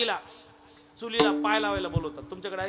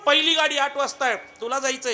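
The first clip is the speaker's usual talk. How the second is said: high, loud wake-up call, slowing on the first words; urgent call to wake; 8 semitones higher, 9 dB louder than usual. Acted. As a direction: shout up the sleeping mother in a hurry. Daughter wakes her mother urgently.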